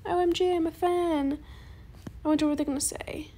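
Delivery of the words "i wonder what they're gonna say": quick whispered aside. Real, not acted. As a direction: whisper it under your breath.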